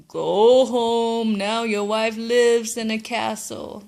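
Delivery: loud fish voice booming the wish granted; magic fish granting. Acted.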